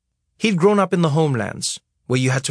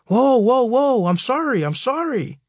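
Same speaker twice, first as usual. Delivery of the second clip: much higher, frantic backing-down pleas; panicked driver; 10 semitones higher than usual. Retold or imitated. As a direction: beg off the big angry man. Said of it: "You'd see the other guy panic".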